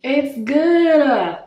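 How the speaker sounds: high happy yay; cheering. Real, not acted. Happy about good taste.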